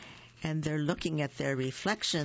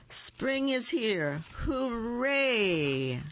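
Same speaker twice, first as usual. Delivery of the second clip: cheering; 8 semitones higher, much slower than usual. A narrator's reading. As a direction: burst into a happy cheer at last. Rabbit finally cheers.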